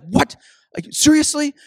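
high, rising disbelief; mock outrage at a card bid; incredulous brother. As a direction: squeak out seriously in disbelief.

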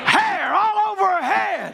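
high, slow, stretched-out holler; triumphant proclamation. Real, not acted. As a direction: cry out the miracle to the congregation. Preacher proclaims the healing.

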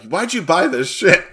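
exasperated parent. Retold or imitated. Son mimics his parents questioning a purchase, mock exasperated. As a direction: imitate a parent's indignant question. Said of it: "flashbacks to my parents".

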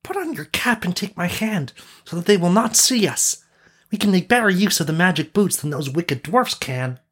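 high-pitched conspiring character voice; high storybook sidekick; Sauron. A narrator's reading. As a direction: urge a companion along in a high voice. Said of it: "said Sauron to the prince".

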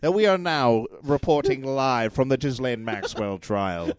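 mock live news report; put-on broadcast voice; silly CNN reporter. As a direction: report it live like a hammed-up anchor. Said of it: "We're switching from the crazy voice".

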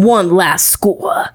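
whispered mobster, slow; hushed gangster menace; New York mobster. Shown as a demonstration. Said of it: "generic East Coast, like, New York".

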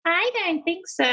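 high, mock-defiant chorus; hands-on-hips sass. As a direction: mimic a squeak back with cheeky defiance. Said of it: "they put their hands on their hips".

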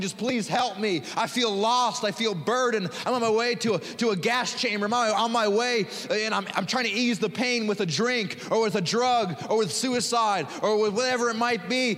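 voicing the lost crying for help; desperate plea. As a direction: cry out for help like someone lost. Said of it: "they're saying".

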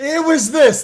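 ordinary talk

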